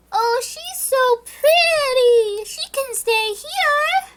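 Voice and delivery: very high, slow, loud lovestruck coo; swooning dwarves. Retold or imitated. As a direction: drag it out like smitten little men.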